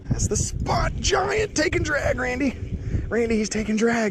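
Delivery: high, slow, stretched shout to a buddy; excited call. Real, not acted. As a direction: call it out like a fish is on. Fish on, calling his buddy.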